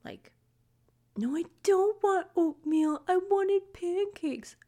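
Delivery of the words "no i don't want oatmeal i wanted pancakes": put-on high, slow whiny child voice; whining kid. Mom mimicking her kid refusing oatmeal, whiny and high. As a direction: imitate a kid whining over breakfast. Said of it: "all they do is whine at me".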